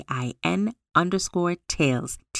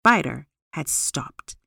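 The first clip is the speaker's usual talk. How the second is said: plain reading voice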